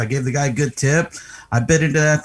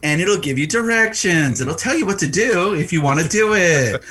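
patronizing explanation; 6 semitones higher than usual. Retold or imitated. Acted explaining to someone, patronizing.

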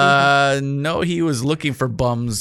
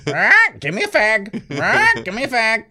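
high, louder squawky parrot begging; British parrot voice; 9 semitones higher, 4 dB louder than usual. Retold or imitated. Co-host imitates UK parrots begging customers, high squawk. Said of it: "These birds were just asking for a cigarette".

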